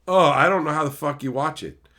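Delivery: normal talking voice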